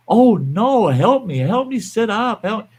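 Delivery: put-on high, pleading old woman; injured mother. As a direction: plead for help from the ground. Injured mother voiced, asking help.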